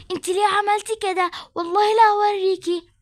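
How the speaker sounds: a cornered mother yelling at her daughter; angry outburst. Acted. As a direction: lash out at the girl. Mother confronted by police.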